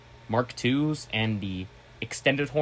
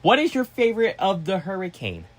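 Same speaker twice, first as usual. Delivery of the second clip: higher, stressing "is" in "What is your favorite of the hurricane?" as a correction; pointed grammar correction; 7 semitones higher than usual. Retold or imitated. Correcting a viewer's grammar, high and pointed on "is". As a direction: say "What is" with the "is" pointed and fully spelled out. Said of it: "Grammar check".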